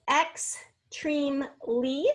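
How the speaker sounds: "extremely" very slow, ex, treme, lee, each apart; "extremely" broken into three slow, separate beats; syllable by syllable. Shown as a demonstration. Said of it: "there's three syllables".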